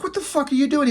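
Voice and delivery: high-pitched mock outrage; retold indignant demand in a high voice; freaked-out woman. Retold woman confronts her captor.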